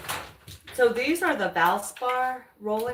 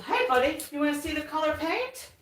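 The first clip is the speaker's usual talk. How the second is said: higher, lively voice for the dog; talking to the dog; 7 semitones higher, faster than usual. Real, not acted. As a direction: coax the dog sweetly.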